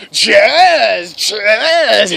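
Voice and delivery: loud, drawn-out bellowed name; exaggerated manly yell, well above talking pitch; manly scream. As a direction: bellow it long and macho. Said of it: "I just start screaming".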